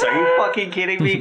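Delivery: high, indignant cry of disbelief; outraged disbelief. Real, not acted. Player reacting to fourteen damage, outraged disbelief. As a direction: voice jumping high at the bad luck. Player shocked by heavy hit.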